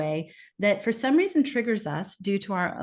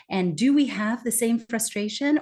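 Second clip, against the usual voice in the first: usual speaking voice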